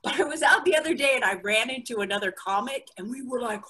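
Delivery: usual speaking voice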